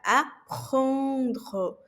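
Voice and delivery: "Apprendre" com o R raspado na garganta; throaty French R. Shown as a demonstration. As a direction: puxe o R do fundo da garganta. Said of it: "o R aqui da garganta". (R gutural francês)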